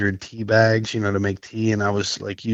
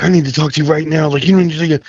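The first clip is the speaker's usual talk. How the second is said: urgent, upset voice; 6 semitones higher, 4 dB louder than usual. Retold or imitated. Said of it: "he could hear it in my voice".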